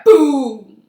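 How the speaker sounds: loud booming blast sound; explosion boom. A narrator's reading. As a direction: mimic the bus blowing up. Bus explodes.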